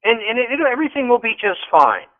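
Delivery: a hint of high mocking sarcasm; mock the lazy voter, lightly; sarcastic mock. Guest mocking complacent voters, lightly sarcastic.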